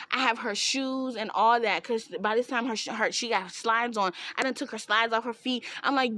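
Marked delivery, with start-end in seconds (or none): none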